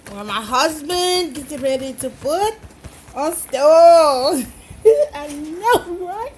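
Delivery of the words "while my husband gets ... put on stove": playful imitation of a Facebook woman's accent, louder on the ending; imitated woman's accent. Cook playfully copying a Facebook woman's talk, imitated accent. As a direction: lightly mimic her accent, swelling loud at the end. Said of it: "she was talking like that".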